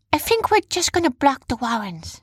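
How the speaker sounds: high-pitched story heroine; Jumba's character voice. A narrator's reading. Heroine proposing a plan to block, high character voice. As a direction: lift the voice well above the narration.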